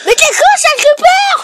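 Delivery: high yell at a creeper; shouting. Real, not acted. Yelling at the creeper.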